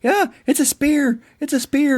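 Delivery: squeaky high-pitched mock voice, imitating a movie character; lightly mock a character's excited line. Mocking a movie character, in a squeaky high voice.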